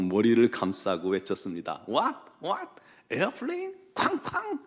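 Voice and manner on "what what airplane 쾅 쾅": mock terrified cry. Foreign passenger hearing of bombing from cab driver, mock terror. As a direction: squeal each word back in comic panic. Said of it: "더 공포에 질려서".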